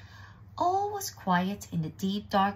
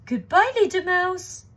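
high, loud owl call; panicked farewell; 12 semitones higher, 9 dB louder than usual. Acted. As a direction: cry it while fleeing in fright. Owl flees in fright.

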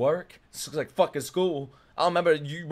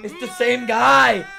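higher, louder, slowed, with a whiny mock cry; mock wail; 8 semitones higher, 7 dB louder, slower than usual. Real, not acted. Streamer seeing the same actor again, mock cry. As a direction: whine it like fake crying.

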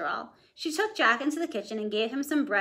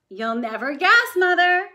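put-on louder, proud boy's boast; proud Jack, louder; teasing excitement; 7 semitones higher, 9 dB louder than usual. A narrator's reading. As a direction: tease her with the surprise. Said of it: "Jack teasing".

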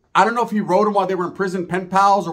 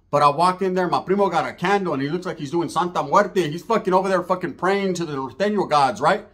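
usual speaking voice

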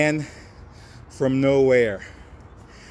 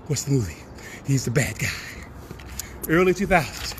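ordinary talk

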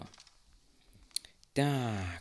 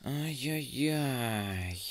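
whisper; 3 semitones higher, much slower than usual. Real, not acted. Tense moment in horror game.

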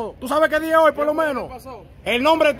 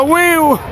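mock firework whistle; 3 semitones higher, 5 dB louder than usual.

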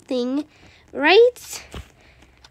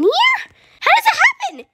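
baffled high question; 4 dB louder than usual. Real, not acted. Finds dead player.

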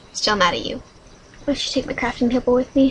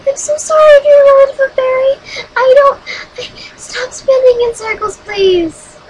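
high, loud, rushed wail; frantic high-pitched begging; panicked apology; 12 semitones higher, 7 dB louder than usual. Real, not acted. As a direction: plead frantically, voice pushed way up. Frantic apology while killing in game.

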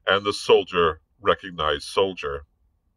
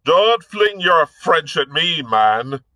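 high, loud irritated snap; angry snap; 9 semitones higher, 6 dB louder, slower than usual. A narrator's reading. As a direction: cut him off sharply. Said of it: "snapped Hobart".

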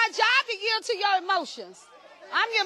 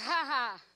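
1 short laugh; 7 semitones lower, 7 dB quieter than usual. Real, not acted. Laughs at own point.

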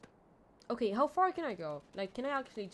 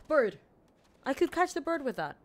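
excited gasp; 8 semitones higher, 9 dB louder than usual. Real, not acted. Spots the bird again.

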